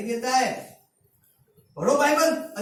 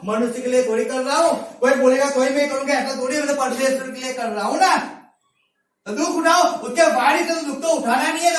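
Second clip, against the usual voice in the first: loud, driving sermon delivery; full-force preaching; 3 semitones higher, 5 dB louder than usual. Real, not acted. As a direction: exhort the congregation with forceful rhetorical questions. (पूरे ज़ोर से ऊँची आवाज़ में उपदेश)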